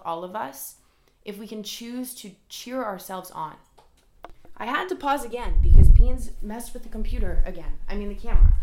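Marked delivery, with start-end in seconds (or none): 4.6-8.5 much louder, flustered about the cat; loud exasperated aside. Real, not acted. Explaining to viewers that the cat messed with the camera, loud and flustered. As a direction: blurt it out, annoyed at the interruption.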